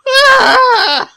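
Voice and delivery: very high, loud cry of a mind snapping; snapping scream. Retold or imitated. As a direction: scream as your mind breaks. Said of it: "And he just goes".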